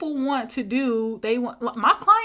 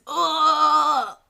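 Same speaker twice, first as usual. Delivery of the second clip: louder, higher strained "ugh"; straining grunt; 4 semitones higher, 4 dB louder than usual. Acted. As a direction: act out bearing down under pressure. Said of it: "that contracting feeling".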